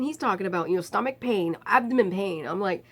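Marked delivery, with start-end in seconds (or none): none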